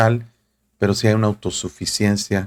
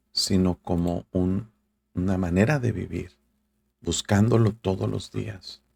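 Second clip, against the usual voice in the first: normal talking voice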